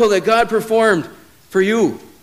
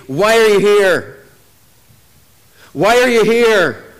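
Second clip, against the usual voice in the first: slow, louder taunt, each word drawn out; Goliath taunting; 2 semitones higher, 5 dB louder, slower than usual. Retold or imitated. Goliath taunting the army morning and night, slow louder taunt. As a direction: lean on the giant's daily challenge, slow and louder. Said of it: "statements of Goliath".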